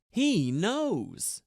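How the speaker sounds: a hint of alarm; worried Cranks. A narrator's reading. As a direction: blurt it out uneasily.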